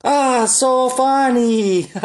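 high, very slow, drawn-out mock voice; mocking so funny. Friend mocking a joke, high slow sarcastic drawl. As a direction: stretch it out like a bored, sarcastic friend. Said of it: "she's like".

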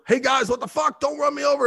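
high-pitched mock panicked call-out; retold alarmed call. Man under the starting car to his buddies, retold alarmed call.

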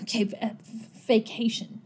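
soft, halting repetition of a word; small, faltering agreement; hesitant acceptance. Acted. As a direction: try the word out quietly and unsurely. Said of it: "She's like".

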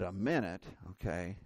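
low, hushed retold reply; subdued acceptance. Man told to wait for restroom, quietly resigned. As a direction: drop right down, quietly taking the delay.

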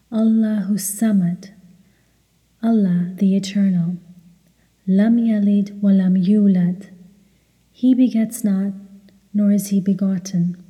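normal talking voice

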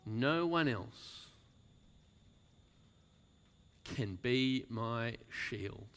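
slow, weighty delivery; slow emphasis. Acted. Preacher slowing to drive the line home. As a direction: lean on each word slowly.

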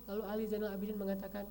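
ordinary delivery

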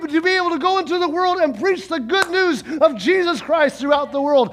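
full-force revival sermon, building joy; fiery preaching. Acted.

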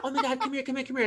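hurried, high-pitched come here; urgent high calling. Retold or imitated. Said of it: "she's like".

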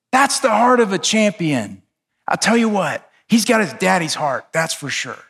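admiring announcer. Retold or imitated. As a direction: sell it like a boxing commentator in awe. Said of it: "then they say this".

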